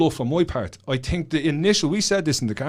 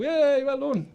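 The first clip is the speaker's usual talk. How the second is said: much higher, bright celebratory whoop; mock cheer; 13 semitones higher, much slower than usual. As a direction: cheer a kid on like a game-show host. Cheering student who grabbed book.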